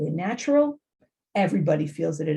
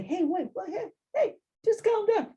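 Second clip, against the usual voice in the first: dismissive calm down; 12 semitones higher than usual. Retold or imitated. Stranger brushing off stressed driver, high and dismissive. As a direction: wave the person off from way up high. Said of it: "you don't want someone to say".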